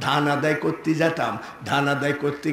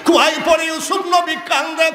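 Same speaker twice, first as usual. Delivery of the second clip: high, impassioned declaiming; high preaching cry; 12 semitones higher than usual. Real, not acted. Preacher tells of Yusuf in the well, crying out high. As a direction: lift the whole line into a high cry.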